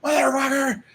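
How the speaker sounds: high screeching mock yell, far above own voice; shrill imitated rant; crazed neighbor yelling. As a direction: shriek it like the wild neighbor swinging her fists. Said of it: "she just starts swinging her fist and she's like".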